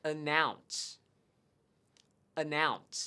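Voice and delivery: "Announce" with NOUNCE stressed; model pronunciation. Shown as a demonstration. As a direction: repeat "Announce" clearly. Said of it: "đó chính là từ announce". (đọc mẫu "Announce")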